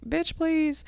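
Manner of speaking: high, sassy brush-off; sassy dismissal. Retold or imitated. Confident podcaster waving off doubters, high and sassy. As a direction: toss it off high with sass. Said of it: "everything else I'm like".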